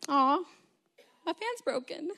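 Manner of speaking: high-pitched little whimper of disappointment; playful fake-sad whine; mock dismay. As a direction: act out a pouty letdown. Retold dismay at broken fan.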